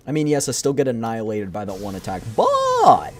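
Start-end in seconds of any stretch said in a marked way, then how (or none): 2.4-3.1 squeaky. Real, not acted. Squeaky protest.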